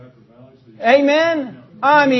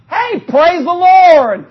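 loud, slow "praise the Lord"; preacher's drawn-out praise; praise shout; 6 semitones higher, 6 dB louder, much slower than usual. Real, not acted. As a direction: lift it up and stretch it out.

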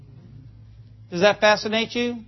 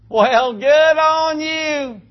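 drawn-out, higher-pitched sarcastic praise; mock congratulation; 6 semitones higher, much slower than usual. Preacher teasing the man who quit smoking, drawn-out mock praise. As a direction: stretch it way out in mock admiration.

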